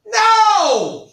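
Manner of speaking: high, loud mock denial; emphatic no. Teacher shoots down his own joke to the class, mock loud.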